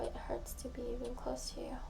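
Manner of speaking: a soft, unvoiced confession; whispering the painful truth into a voicemail; whispered. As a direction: let it slip out on breath alone. Tearful late-night voicemail confession.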